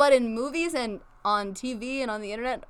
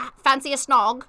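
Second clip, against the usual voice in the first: louder hammed-up British accent; British caricature, a bit louder; mock British accent; 6 dB louder than usual. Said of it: "weird kind of British".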